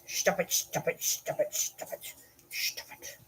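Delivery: from quiet to a whisper; fading to a whisper. Real, not acted. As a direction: let each stop it die away to a whisper. Dog licking her face.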